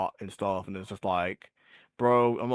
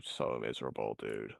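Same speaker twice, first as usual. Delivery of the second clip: miserable; 12 semitones lower, 11 dB quieter than usual. Real, not acted. Trailing off about AI, dejected.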